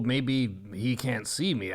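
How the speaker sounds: normal talking voice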